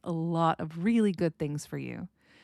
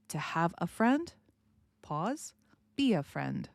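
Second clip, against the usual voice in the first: "To have a friend" rising, a clear pause, then "be a friend" falling; pause at the comma. Shown as a demonstration. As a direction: stop briefly at the comma. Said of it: "So the pause will go where there is a comma".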